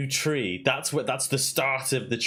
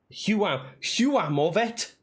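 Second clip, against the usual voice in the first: Huam of Et in a put-on Russian accent that drifts toward Welsh, pitched higher and drawn out slowly; mock Russian accent; 7 semitones higher, slower than usual. Shown as a demonstration. Trying her Russian accent on the new phrase, higher and slower mock accent. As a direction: try Huam of Et in a playful Russian-Welsh accent, slow and high. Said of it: "And when I say it like that".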